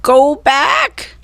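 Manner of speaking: high parrot squawk. A narrator's reading. Parrot Polly squawks a warning at the children. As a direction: squawk it like a bird. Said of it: "she squawked".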